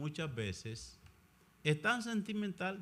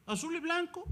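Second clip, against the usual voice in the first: repeated much higher, mock-emphatic; high echo; 14 semitones higher than usual. Priest echoing his own call for the Virgin's colours, much higher.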